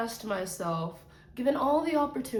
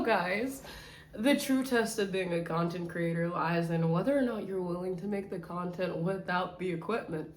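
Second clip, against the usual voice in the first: normal talking voice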